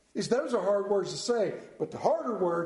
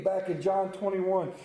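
ordinary talk

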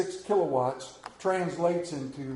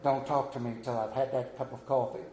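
lower, softer grouchy morning voice; grumpy coffee drinker; 5 semitones lower, 8 dB quieter than usual. Retold or imitated. Mocking grouchy coffee drinkers to the church, lower grumble. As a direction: grumble it like you haven't had coffee. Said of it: "a lot of people are like".

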